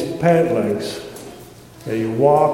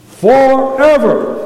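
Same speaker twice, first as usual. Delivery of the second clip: Forever stretched slow, louder and higher; louder preached emphasis; 8 semitones higher, 6 dB louder, slower than usual. Shown as a demonstration. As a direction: hammer Forever home, building. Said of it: "One more time".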